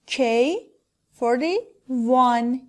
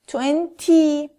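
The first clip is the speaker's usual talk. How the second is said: twenty forty seventy with a crisp, firm t before the y; strong final t; 2 semitones higher, slower than usual. Shown as a demonstration. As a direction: pop the t clearly in each ty. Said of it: "محکم تلفظ کنید".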